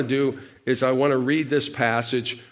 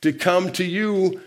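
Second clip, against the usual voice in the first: high-pitched, bold acted request; imitating a bold, eager plea; big and bold; 6 semitones higher than usual. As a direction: make the request boldly, voice raised. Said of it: "He gets big and bold".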